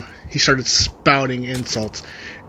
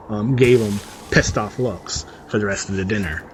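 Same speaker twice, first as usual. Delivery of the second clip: ordinary talk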